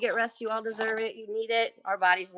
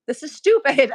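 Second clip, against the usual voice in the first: exasperated quote; 3 semitones higher, 7 dB louder than usual. Retold or imitated. Exasperated quote to phone company.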